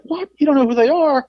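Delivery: high-pitched, incredulous interviewer; mock disbelief. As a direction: imitate an interviewer who can't believe his ears. Interviewer shocked at dismissive answer.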